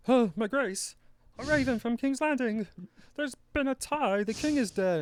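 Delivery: very high, loud messenger voice; hammed-up royal messenger. Messenger bringing raven news to Sansa, hammed-up high voice. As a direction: announce urgent news in a squeaky put-on voice.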